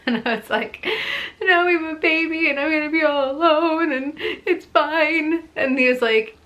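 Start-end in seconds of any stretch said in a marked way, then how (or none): 1.4-5.5 high, loud sing-song self-pity; hammed-up retold lament; melodramatic lament. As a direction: wail it like a drama queen sure she'll be alone. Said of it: "I'm such a drama queen".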